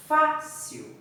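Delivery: "fácil" with a high, forceful FÁ, then falling away; stress on first syllable. Shown as a demonstration. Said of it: "Eu falo com mais força". ("fácil" com mais força no fá)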